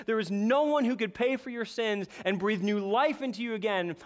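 usual speaking voice

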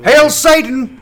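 high, booming mock proclamation; hammed-up theatrical shout; Patrick Stewart. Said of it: "stay in Patrick Stewart voice".

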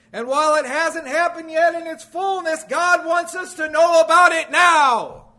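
loud, high pulpit cry; impassioned preaching. Real, not acted. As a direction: drive it home at full preaching force. Preaches at full force.

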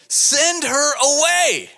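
very high, loud, drawn-out demand; insistent pleading yell; begging. Acted. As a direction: shout it slowly, begging to be rid of her. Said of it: "They were begging him".

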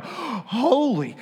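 sharp gasp, then a high, hushed holy; awestruck gasp. Retold or imitated. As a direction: catch your breath in awe. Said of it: "see another piece ... and go".

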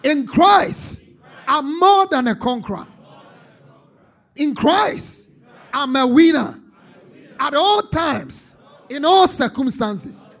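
high, very loud triumphant preaching; shouted declaration. Shown as a demonstration. Preacher leads neighbours in claiming victory, full shout. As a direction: shout each phrase as a victory cry. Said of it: "Shout it aloud".

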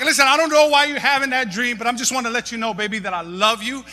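high, building to a loud preach; hammed-up reassurance building to shout. Husband reassuring his wife, building to a loud proclamation.

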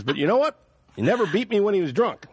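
high-pitched imitation of a rural Canadian accent; backwoods Canadian accent. Driver speaks up for his daddy, backwoods Canadian accent. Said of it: "kind of doing his accent".

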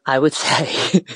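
nervous laugh mid-phrase; a shy chuckle before confessing. Real, not acted. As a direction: laugh it off while hesitating. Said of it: "if I'm completely honest".

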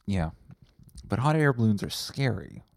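ordinary talk, nothing marked

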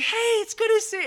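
high and quick fake-friendly greeting; overfriendly salesgirl. Salesgirl pretending she knows Jess, high quick and gushing. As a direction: rattle it off like a salesperson faking familiarity.

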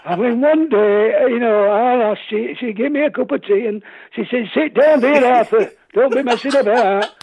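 put-on high-pitched old grandad with stuff stuck in his throat; old man based on granddad. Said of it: "it's based on my granddad".

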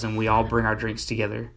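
ordinary delivery

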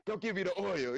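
acting holy. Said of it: "I'm acting like I know what time it is".

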